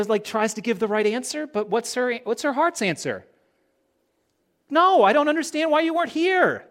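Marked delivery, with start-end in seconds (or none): 4.7-6.7 high, loud, pleading protest; anguished outcry. Acted. Martha crying out to Jesus in high, loud protest. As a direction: cry it out in frustrated grief. Martha's heart's answer voiced.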